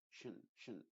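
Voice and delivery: shin, shin with a soft sh hiss; sh sound demo. Shown as a demonstration. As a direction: isolate the sh in shin, shin. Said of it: "una S H". (sonido sh en shin, shin)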